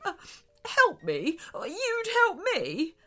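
high, questioning voice just out of tears; tearful disbelief. A narrator's reading. Shark hears the mermaid's offer, tearfully amazed. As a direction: sniffle and ask, hardly believing it. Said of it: "stopped his sniffling for a moment".

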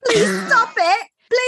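high, slow mock British plea; British accent, echoing the friend's impression. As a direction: copy her British voice. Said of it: "You do it so good".

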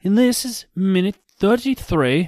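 lightly mocked Australian accent. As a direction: say it like a bad Aussie impression. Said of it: "Is that Australian".

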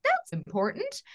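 high, startled realization; teen self hit by a worrying thought, pitched high; sudden realization. Retold or imitated. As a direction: catch yourself realizing something big. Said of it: "And I just was like".

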